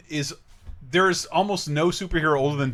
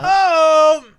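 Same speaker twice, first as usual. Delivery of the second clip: sudden high, loud yelp of surprise; surprised shout; 13 semitones higher, 10 dB louder than usual. Real, not acted. Watching the movie with friends, a startled shout. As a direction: jolt out a startled cry.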